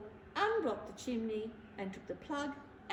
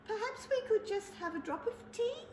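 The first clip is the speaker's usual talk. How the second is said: much higher, polite squeak; little mouse voice; 10 semitones higher than usual. Acted. The mouse politely asks Bear for tea in a high voice. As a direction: ask sweetly like a tiny mouse. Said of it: "said the mouse".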